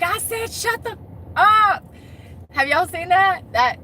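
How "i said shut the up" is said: high imitation of a fed-up girlfriend, bursting on the last word; hammed-up angry retort; mock angry outburst. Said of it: "she's just getting angrier and angrier".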